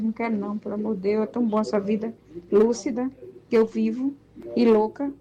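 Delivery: ordinary talk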